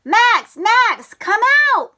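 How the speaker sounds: high, loud yell of a friend's name. Acted. Froggy yelling outside his friend's house. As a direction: call him out loud and high. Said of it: "he yelled".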